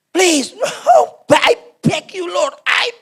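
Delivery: high tearful plea to the Lord; drawn-out, high-pitched pleading; crying, begging. Acted. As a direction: beg desperately through acted tears. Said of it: "Dia nangis".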